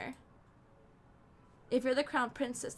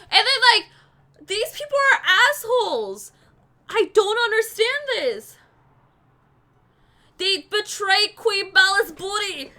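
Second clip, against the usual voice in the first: loud, high outraged rant; 7 semitones higher, 12 dB louder than usual. Real, not acted. Reader rants about the rude townsfolk, loud and high. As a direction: vent at the story at full volume. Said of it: "I have to rant".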